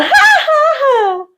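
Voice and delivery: very high, spaced-out ha-ha laugh; fake girly laugh. Shown as a demonstration. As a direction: imitate a put-on girly movie laugh. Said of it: "they do this laugh when they're pretending".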